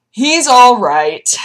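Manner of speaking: slow, high pet voice; reassuring coo. Real, not acted. Owner reassuring viewers about her cat, slow coo. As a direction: soothe the cat gently.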